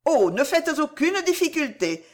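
high, moved pleading; emotional exclamation. A narrator's reading. Madame Harel begs Cécile not to object, moved exclamation. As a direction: plead with feeling, pitch lifted. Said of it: "s'écria madame Harel extrêmement émue".

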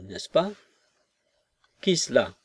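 usual speaking voice

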